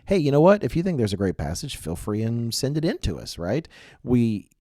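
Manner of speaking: ordinary talk, nothing marked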